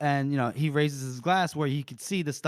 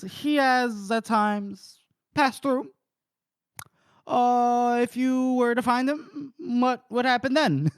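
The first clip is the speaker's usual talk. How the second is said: put-on higher, slow, hesitant old mayor; mayor's voice; 6 semitones higher, slower than usual. Narrator voicing the mayor asking about the fugitive, slow and higher. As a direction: draw it out like a wary village mayor.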